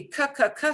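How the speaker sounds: "K" said as a quick repeated hard consonant; hard C sound. Shown as a demonstration. Teacher previews the sounds to practise, hard C sound. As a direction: pop out "K" like the C in cat. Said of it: "it'll have the hard K".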